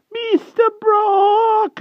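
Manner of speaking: very high, drawn-out imploring cry; hedgehog voice. A narrator's reading. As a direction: implore him desperately. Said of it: "implored the unfortunate pig".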